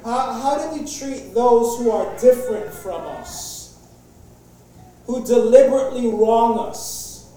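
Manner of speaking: ordinary delivery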